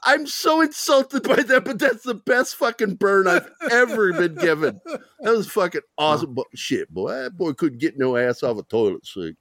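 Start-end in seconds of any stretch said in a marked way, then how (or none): 0.0-4.8 half-laughing mock outrage at the burn; laughing while insulted. Teasing a buddy, laughing through mock outrage. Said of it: "I started laughing, going".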